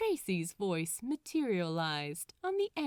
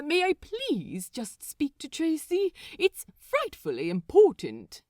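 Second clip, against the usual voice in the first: louder, fussy and pleading; pleading Aziraphale; 6 semitones higher, 7 dB louder than usual. Acted. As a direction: beg with prim urgency. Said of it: "Aziraphale pleaded".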